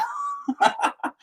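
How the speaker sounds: high, breathy burst of laughter; high cackling laugh. Real, not acted. Laughing at own English impression, high cackle. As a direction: crack up at your own joke.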